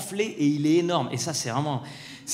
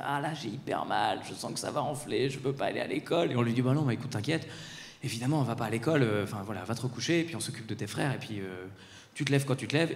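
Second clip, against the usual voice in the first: hushed retelling; 6 semitones lower, 9 dB quieter than usual. Retold or imitated. Sleepy early-morning exchange retold.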